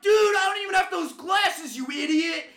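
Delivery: high, loud exasperated shout; shouting back. Retold or imitated. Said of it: "And I'm like".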